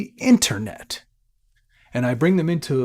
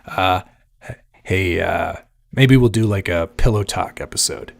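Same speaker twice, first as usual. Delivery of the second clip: low, smooth late-night DJ voice; shock jock radio host; 5 semitones lower, 5 dB louder than usual. Pretend. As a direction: play a sleazy radio host. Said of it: "this is my best shock jock impression".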